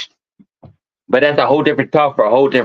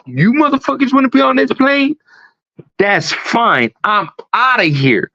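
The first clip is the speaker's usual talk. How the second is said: high, panicky mock passenger; imitating freaked-out woman; 9 semitones higher than usual. Retelling a passenger freaking out at a flight attendant, high panicky voice. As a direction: play a frantic woman storming off. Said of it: "freaked out".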